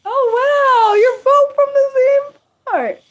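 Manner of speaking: very high, loud squeal of amazement; excited squeal. Real, not acted. Reacting to two people from the same place, excited squeal. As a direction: squeal it out at the top of your voice.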